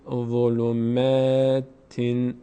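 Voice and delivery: slow "ظلماتین" with a light, long "mā" and final "tin"; thin "m". Shown as a demonstration. Teacher models word for learners, thin drawn-out "ma". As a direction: draw out "ظلماتین", keeping the "ma" fronted. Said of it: "پر نمیشه".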